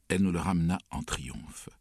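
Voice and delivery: plain reading voice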